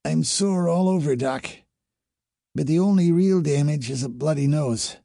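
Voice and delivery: high, strained voice of a worn-out crewmate; hoarse. A narrator's reading. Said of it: "Her voice was hoarse".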